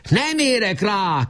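high, slow call over the shoulder; shouting back. A narrator's reading. Nemecsek shouts back to Weiss, running off. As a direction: yell it back while running. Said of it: "kiáltotta vissza Nemecsek".

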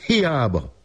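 high lament. A narrator's reading. Narrator laments the failed search.

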